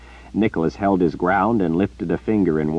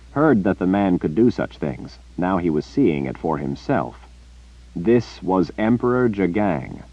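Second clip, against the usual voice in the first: ordinary delivery, nothing marked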